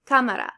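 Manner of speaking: cámara stressed loudly on CÁ, CÁmara; stress on accented syllable. Shown as a demonstration. Teacher showing learners accent-mark stress, CÁmara. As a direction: punch the first syllable of cámara, falling after. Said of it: "where you're gonna place the emphasis".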